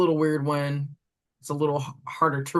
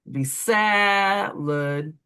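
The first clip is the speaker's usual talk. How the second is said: "salad" with SA stretched slow, higher and louder; stress on SA; 5 semitones higher, much slower than usual. Shown as a demonstration. Teacher showing kids which syllable is loudest in "salad". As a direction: lean hard on the first syllable of "salad". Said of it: "say the word out loud".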